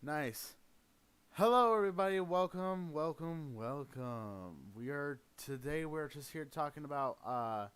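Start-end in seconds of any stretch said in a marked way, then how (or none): 1.3-2.2 big loud hello to viewers; loud greeting. Real, not acted. Streamer greets new viewers. 2.2-4.6 fading welcome. Real, not acted. Repeated greeting winding down.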